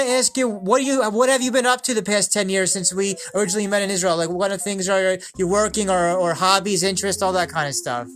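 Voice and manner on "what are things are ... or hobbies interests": ordinary talk, nothing marked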